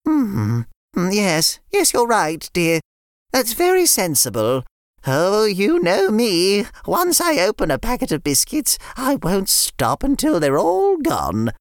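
put-on a fond nan greeting the kids; sweet old nan. Nan voiced.

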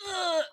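loud, high burst of pent-up energy; pent-up grunt. Retold or imitated. Mum imitating her overexcited son, loud pent-up grunt. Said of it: "he just gets stuck in himself".

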